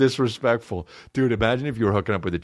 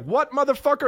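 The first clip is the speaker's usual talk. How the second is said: very high indignant shout; mock outrage. Woman offended by the compliment, very high and indignant. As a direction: snap back indignant. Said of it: "she's like".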